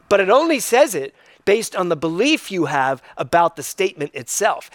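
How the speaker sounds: ordinary talk, nothing marked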